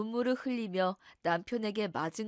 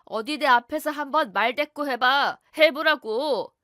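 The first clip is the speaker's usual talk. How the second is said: loud, menacing husband barking a dare; angry husband; 3 semitones higher, 10 dB louder than usual. Acted. Abusive husband bullying his wife, loud threat. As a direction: dare her to talk back, loud and hostile.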